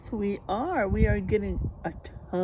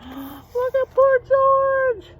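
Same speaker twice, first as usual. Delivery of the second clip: gasp, then much higher and louder, fading to a whisper; mock shocked gasp; 14 semitones higher, 4 dB louder, much slower than usual. Real, not acted. Reader shows the picture to children, gasping then whispering.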